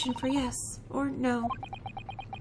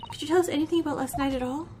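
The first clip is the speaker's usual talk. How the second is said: ordinary delivery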